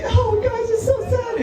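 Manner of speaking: high mock-sad sigh, hammed-up; fake grieving sister. Sister at grandma's funeral, hammed-up fake sadness. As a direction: put on sister's phony sorrow voice.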